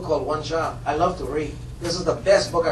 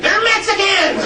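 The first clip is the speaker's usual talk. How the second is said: much higher shouted insistence, slowed; shouting; 14 semitones higher, 7 dB louder, slower than usual. Retold or imitated. A classmate shouts that the Dominican kid is Mexican. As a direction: yell it down the hallway.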